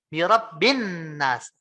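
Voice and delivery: "birabbin nas" with a long held N; bacaan gunnah. Shown as a demonstration. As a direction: hum on the double N of "birabbin nas". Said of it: "tapi kita bacanya". ("birabbin nas" dengan nun ditahan mendengung)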